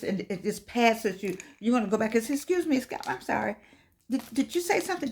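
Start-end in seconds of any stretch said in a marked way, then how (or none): 2.3-5.1 high, tentative apology; polite pretext. Acted. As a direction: act out a coy, higher-pitched excuse. Acting someone fishing to hear voice.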